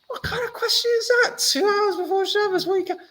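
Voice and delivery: very high, squeaky indignant rant; exasperated outburst. Acted. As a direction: shriek it in disbelief. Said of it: "that's not how he responded".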